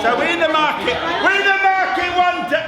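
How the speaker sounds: shouted over the room; loud call. Real, not acted. Teacher shouting to start the market scene. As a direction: call the scene to order loudly. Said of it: "calling the class to attention".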